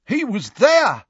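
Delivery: high, loud, urgent shout. A narrator's reading. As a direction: shout it out as an alarmed witness. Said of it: "shouted a scarlet-robed man".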